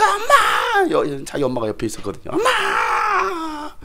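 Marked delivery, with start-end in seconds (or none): 0.0-0.9 high, loud cry of pain; mock agonised shout; screaming for mom. Wife calls mother in pain. 2.3-3.8 very high, drawn-out howl; acted-out agonised scream; screamed labour wail. Wife screaming through labour pains.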